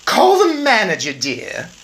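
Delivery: high, loud, slow and sneering; haughty Mrs Jenkins. Acted. Snobbish mother dismisses the old woman, high and loud. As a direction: look down your nose at her. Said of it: "Miss Jenkins said".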